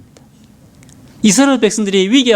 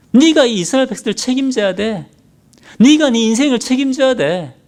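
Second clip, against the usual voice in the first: tempting Satan; 6 semitones higher than usual. Retold or imitated. Satan goading King Saul in a crisis, higher and pushy. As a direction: goad Saul like a serpent. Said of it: "사탄이 사울에게 속삭이는 겁니다".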